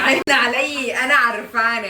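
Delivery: loud laughing protest. Real, not acted. Laughing at mother's evil eye.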